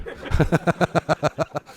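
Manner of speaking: a real laugh, high and falling; amused laugh. Real, not acted. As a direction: laugh at the punchline of the story. Laughing at his own story.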